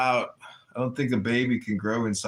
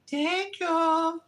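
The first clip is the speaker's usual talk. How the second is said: high, whiny immigrant tenant voice; mock tenant voice. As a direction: whine like a fretful tenant. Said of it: "She's like".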